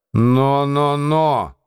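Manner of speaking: a growling warning, half acted; growled. A narrator's reading. Andreev to Lambert, a growled warning. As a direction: rumble it at him like a threat. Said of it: "рыкнул на него Андреев".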